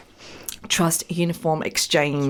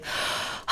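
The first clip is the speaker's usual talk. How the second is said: long, soft acted sigh; demonstrated sigh of an overwhelmed parent; weary sigh; 8 dB quieter than usual. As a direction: let out a heavy breath of self-doubt. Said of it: "where you're just feeling".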